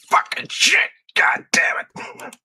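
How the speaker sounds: high yelled frustrated curses; angry golf cursing. Pretend. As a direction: blow up after a bad shot. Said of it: "Use your golf voice".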